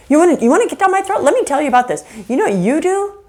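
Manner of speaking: angry counterattack. Acted. Attacked spouse snaps back at partner, fast and heated. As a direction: fire right back at them.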